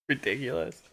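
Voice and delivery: ordinary talk, nothing marked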